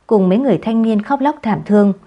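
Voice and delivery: ordinary delivery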